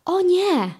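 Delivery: high-pitched worried "oh no"; sighing dismay. A narrator's reading. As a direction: voice a character's dismay. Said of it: "westchnął Carlos".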